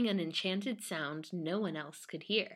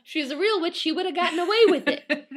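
excited; 10 semitones higher, 9 dB louder, faster than usual. Real, not acted. Joking witch claim, loud high.

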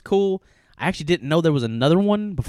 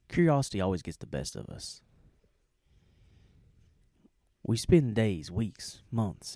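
mock-serious reading, softer; mock solemn reading; 6 semitones lower, 9 dB quieter than usual. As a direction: read it like a grave essay. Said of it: "commented and said".

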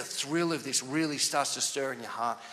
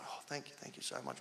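moved thanks; 2 semitones lower, 17 dB quieter than usual. Retold or imitated. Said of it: "Really, really moved".

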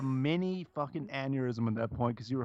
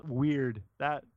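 ordinary talk, nothing marked

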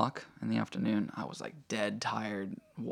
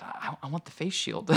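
retold pleading; 7 semitones higher than usual. Husband pleads for a face shield.

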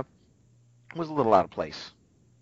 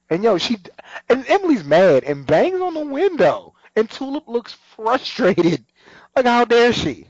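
excited retelling; 11 semitones higher, 5 dB louder than usual. Real, not acted.

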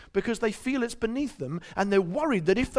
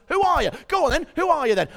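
higher, louder goading challenge; mock taunting biker; motorcyclist taunting; 10 semitones higher, 5 dB louder than usual. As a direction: needle him like a cocky biker. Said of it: "And the guy says".

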